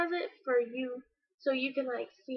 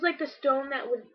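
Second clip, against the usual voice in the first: ordinary talk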